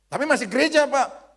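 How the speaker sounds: high, loud mock objection; protesting churchgoer. Churchgoer objecting to the pastor, high mock protest. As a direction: protest loudly as an imagined congregant.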